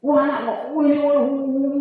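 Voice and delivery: high mock scolding of noisy kids; hammed-up fed-up parent; exasperated parent. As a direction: throw up your hands at the children, high and loud.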